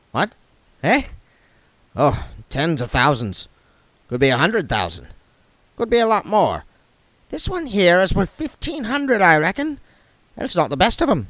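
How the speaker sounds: voicing Goodmountain the dwarf; dwarf appraising gems, grumbling; gruff dwarf. A narrator's reading.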